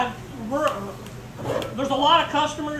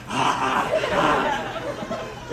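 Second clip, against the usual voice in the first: imitating boys' laughter; 9 semitones lower, 3 dB quieter than usual. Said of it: "they're just like".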